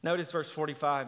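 ordinary talk

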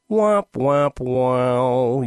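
sad trombone "womp womp"; descending mock horn, voiced by mouth; losing-horn imitation. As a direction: mimic a game-show failure horn. Imitates game show losing horn.

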